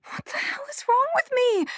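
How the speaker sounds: frightened whisper; hushed, breathless panic. Acted. Girl hearing voices whispers to herself in fear.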